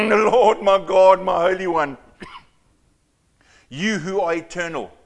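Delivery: booming prayer. Shown as a demonstration. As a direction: proclaim it like a formal public prayer. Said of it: "this is how I think he was speaking".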